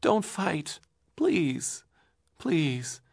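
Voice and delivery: put-on a boy clinging to his father; pleading cry. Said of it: "cried Leonard".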